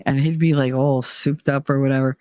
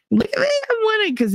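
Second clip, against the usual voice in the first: fast, higher, sing-song look at me, I'm winning; mocking gloat; faster than usual. To her ex-husband, mocking how he gloated: fast smug singsong. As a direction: mimic his smug gloating, quick and raised. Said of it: "with the whole".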